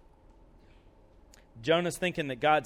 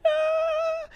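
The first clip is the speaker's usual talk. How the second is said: contented ah. Acted. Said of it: "Jonah's like".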